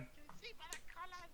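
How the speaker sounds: high creature voice. Acted. Character voice to kobold.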